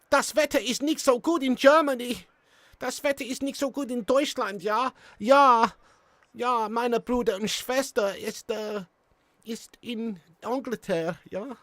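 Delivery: high-pitched halting mock German; comic German accent. Streamer tries German for the chat, high comic German accent. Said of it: "my best German accent".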